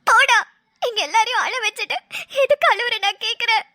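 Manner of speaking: scolding through tears; tearful reproach to a loved one. Acted. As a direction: cry while telling him off, pitch slightly up. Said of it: "ஏன் அழற?".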